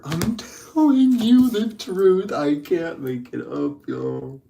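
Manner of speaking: high, slow laughing talk; cracking up while insisting. Real, not acted.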